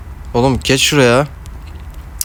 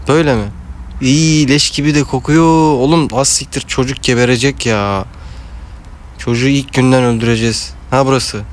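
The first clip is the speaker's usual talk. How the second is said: ordinary delivery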